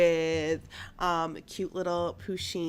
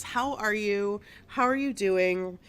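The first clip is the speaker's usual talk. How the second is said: usual speaking voice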